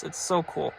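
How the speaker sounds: ordinary delivery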